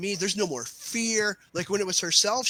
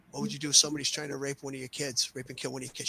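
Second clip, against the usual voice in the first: low, hushed question getting softer; quiet provocation; 4 semitones lower, 6 dB quieter, faster than usual. Retold or imitated. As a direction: drop your voice to press her hard. Instructor presses mother about her kids.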